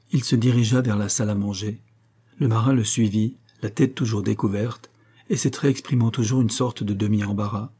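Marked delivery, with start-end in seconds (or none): none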